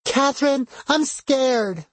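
put-on high, frightened child; small scared girl calling for help; little girl voice. A narrator's reading. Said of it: "in her little girl voice".

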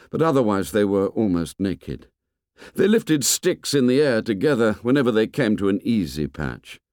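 usual speaking voice

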